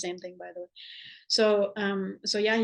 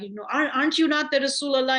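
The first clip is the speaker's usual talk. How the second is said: pitched up and louder, demanding; exasperated protest, higher and louder; retold angry challenge; 5 semitones higher, 4 dB louder, faster than usual. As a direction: confront the Prophet with indignant disbelief. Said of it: "and he's angry".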